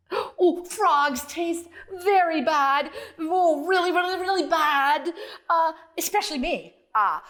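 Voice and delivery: oversell how bad you taste; panicky frog. Frog pleads not to be eaten.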